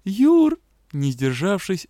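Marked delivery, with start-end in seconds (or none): 0.1-0.6 tearful cry. Acted. Sobbing reunion.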